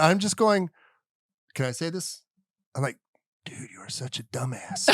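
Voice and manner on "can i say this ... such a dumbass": hushed, much softer insult; muttered aside. Retold or imitated. Dad muttering to his belligerent son, hushed. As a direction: drop to a confidential mutter, as if slipping it past.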